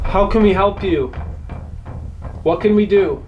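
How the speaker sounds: high, projected questioning; calling to spirits. Real, not acted.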